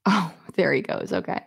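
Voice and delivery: ordinary talk, nothing marked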